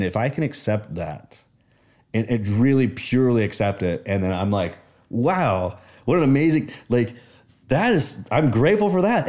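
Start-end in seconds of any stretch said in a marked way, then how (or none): 5.1-9.3 awed gratitude. Retold or imitated. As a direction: marvel as if it's a revelation. Sudden gratitude exclaimed.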